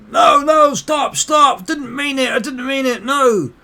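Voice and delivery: high, loud frantic pleading; panicked begging. Acted. Beaten man begs attacker to stop, panicked pleading. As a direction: cry out for the beating to stop.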